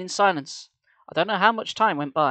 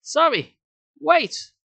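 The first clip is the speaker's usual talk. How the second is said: high, loud insisting; boy insists she waits, higher and louder; insistent; 12 semitones higher, 6 dB louder, much slower than usual. A narrator's reading. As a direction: stop her firmly. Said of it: "I insisted".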